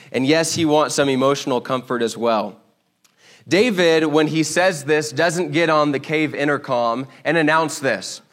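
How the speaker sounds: usual speaking voice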